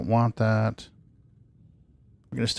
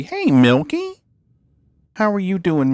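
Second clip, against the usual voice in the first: pet voice; 9 semitones higher than usual. Real, not acted. Greeting the cat, pet voice.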